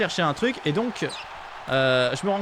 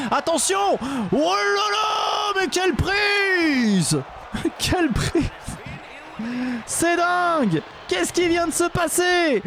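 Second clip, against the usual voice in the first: high, slow, shouted ringside hype; wrestling commentator; 7 semitones higher, slower than usual. Pretend. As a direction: bellow it like a ringside announcer. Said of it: "on fait comme si tout était réel".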